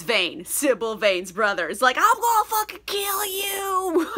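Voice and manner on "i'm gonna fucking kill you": high-pitched mock fury; angry threat. Brother threatens his sister's supposed killer, mock angry. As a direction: put on a high, hammed-up angry voice. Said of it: "he's so angry".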